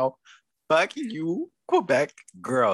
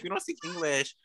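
quieter, dropped-down repeat of the mock line; mock snooty Quebec girl, softer; 8 dB quieter than usual. Mocking Quebec girls again, softer mock snooty repeat. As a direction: let the parody fall away into a soft mutter. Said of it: "And now they're over here like".

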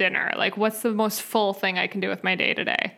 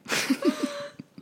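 high laugh; 4 dB quieter than usual. Real, not acted. Laughing at herself.

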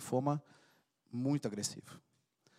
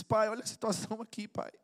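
lightly voiced plea to God; 5 semitones higher than usual. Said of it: "Você diz".

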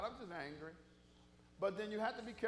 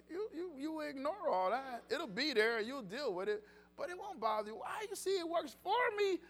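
loud, rising gospel preaching, driving each line; full-force preaching; 4 semitones higher, 10 dB louder than usual. Acted. Pastor urging the congregation to stay in church, loud preaching. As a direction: preach it out to the back pews.

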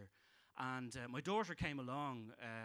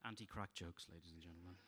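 very low and much softer; deadpan aside; 9 semitones lower, 12 dB quieter than usual. Acted. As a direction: drop it flat and quiet. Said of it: "deadpan catchphrase after jokes".

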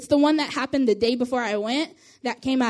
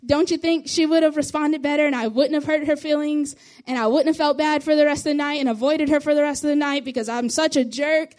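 ordinary talk, nothing marked

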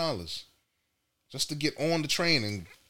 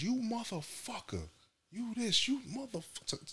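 screaming wife; 8 semitones higher, 9 dB quieter than usual. Retold or imitated. Wife yelling at cab driver.

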